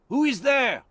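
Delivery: high, loud call into the fog; loud high sentry shout; sentry challenge. Acted. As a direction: call out sharply to strangers. Soldier challenges voices in fog.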